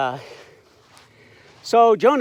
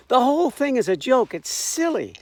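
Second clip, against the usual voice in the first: ordinary talk, nothing marked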